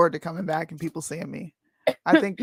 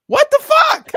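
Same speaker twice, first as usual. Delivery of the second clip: real high-pitched shocked outburst; startled octave jump; 11 dB louder than usual. Real, not acted. Co-host startled by an apparent flash, real high shriek. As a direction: yelp it far higher and louder, truly startled. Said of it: "My voice went up a whole octave".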